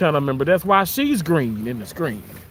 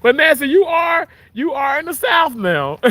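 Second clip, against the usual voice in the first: much higher and louder teasing call; playful shout; 11 semitones higher, 5 dB louder than usual. Real, not acted. Teasing a viewer about being in the South, playful shout. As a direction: holler it at her with a grin.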